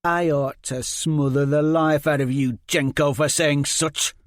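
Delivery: loud, seething threat; simmering anger. Acted. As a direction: hold the fury just under the lid. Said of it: "Liz Hen's voice simmered".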